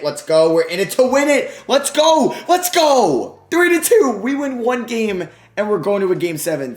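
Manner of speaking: high, loud hype yelling; celebratory shouting. Real, not acted. Gamer celebrating his comeback win, hype shouting. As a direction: celebrate like you just won. Celebrating forcing game seven.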